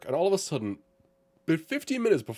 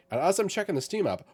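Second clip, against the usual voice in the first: ordinary talk, nothing marked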